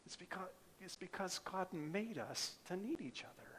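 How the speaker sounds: hushed whisper on the key truth. Acted. Preacher to congregation, whispering that God made us need each other. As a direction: lean in and breathe it out.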